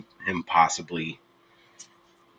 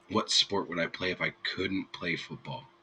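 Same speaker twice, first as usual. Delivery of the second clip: ordinary talk, nothing marked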